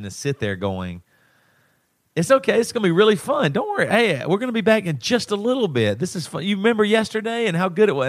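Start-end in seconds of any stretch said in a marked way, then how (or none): 2.1-8.1 put-on high-pitched, over-bright comforting of a child; imitated forced reassurance. Dad reassuring crying five-year-old at school, high forced cheer. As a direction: play a dad cheering up his kid while holding back tears. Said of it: "I'm having to say all this stuff through".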